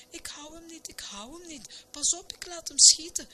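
high, strained storybook warning; struggling panic. A narrator's reading. Minister losing grip on the stove.

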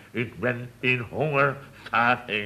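slow hiss from the side of mouth. Retold or imitated. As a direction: hiss it slyly out of the mouth corner. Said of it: "Dus ik sis vanuit mijn mondhoek".

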